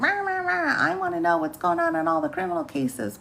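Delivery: a blustering wrestler parody; angry wrestler. Retold or imitated. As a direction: ham up a furious pro wrestler's rant. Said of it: "seems angry all the time".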